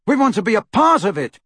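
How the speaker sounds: high, loud, heated insistence; angry and pitched right up; angry Neville. A narrator's reading. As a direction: insist angrily on taking part. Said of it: "said Neville angrily".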